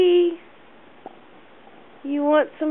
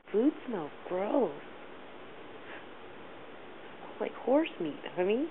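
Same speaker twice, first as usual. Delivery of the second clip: quiet; 7 semitones lower, 16 dB quieter than usual. Real, not acted. Grumbling aside about cat food.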